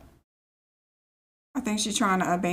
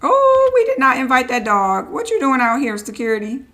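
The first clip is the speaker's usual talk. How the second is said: much louder, delighted exclaim; excited outburst; 3 semitones higher, 9 dB louder than usual. Real, not acted. Spotting the uninvited dog, loud and delighted. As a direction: call it out in happy surprise.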